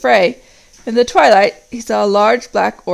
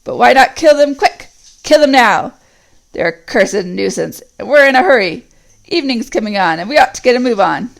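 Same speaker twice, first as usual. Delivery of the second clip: impatient orc; 3 semitones higher, 6 dB louder than usual. Acted. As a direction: push them to kill the prisoners now. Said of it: "said another".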